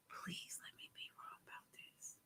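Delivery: barely audible, voiceless whisper; whispered plea. Real, not acted. Host pleading to be wrong about the news, whispering. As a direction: whisper it like a private prayer.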